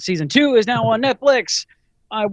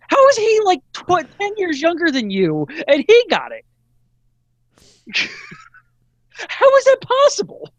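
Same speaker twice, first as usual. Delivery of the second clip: squealing disbelief. Real, not acted. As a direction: shriek it in outraged disbelief. Outraged disbelief about Dale.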